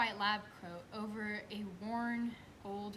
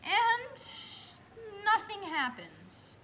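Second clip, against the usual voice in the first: exasperated letdown; 10 semitones higher, 6 dB louder, slower than usual. Real, not acted. Comic letdown after pushing.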